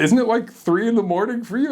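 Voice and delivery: high, startled question; surprised. Retold or imitated. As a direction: sound shocked at the hour. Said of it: "he's like".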